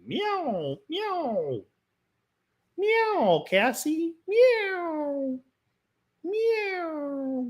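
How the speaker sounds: very high playful meowing; cat meows. Pretend. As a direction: tease the dog with high cat sounds. Imitating a cat to tease dog.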